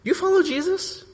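put-on very high girlish questioning; junior high girl. Junior high girl questioning Peter, high-pitched. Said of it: "this junior high girl comes along".

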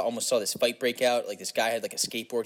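ordinary talk

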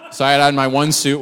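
laughing through the words, loud; chuckling about his one suit. Real, not acted. Pastor laughs telling the congregation about his funeral tie, laughing.

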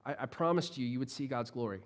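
quiet, tender reassurance of a friend; gentle Jesus. Acted. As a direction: soothe her gently, trust me. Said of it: "It's such a gentle response".

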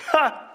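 high, loud scornful laugh; defiant laugh. Acted. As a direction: laugh off the threat. Mock laugh at enemies.

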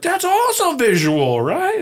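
loud, high-pitched gushing; imitated excitement. Speaker mimicking clients delighted by a visual idea, excited. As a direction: exclaim it like a thrilled client.

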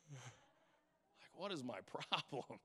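whispered yawny aside; sleepy mumbled aside; mock yawn. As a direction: say it through a half yawn. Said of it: "I yawned about fourteen times".

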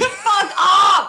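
loud, high retort through laughter; laughing shout. Real, not acted. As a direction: shout it back, cracking up. Laughing retort to mock apology.

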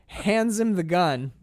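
ordinary talk

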